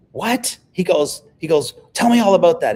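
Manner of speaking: retold burst of keen interest; excited retelling. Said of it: "he got all excited".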